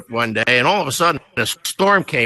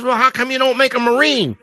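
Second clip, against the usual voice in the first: higher, mock-offended sailor; indignant question; 10 semitones higher than usual. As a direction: demand it, feeling slighted.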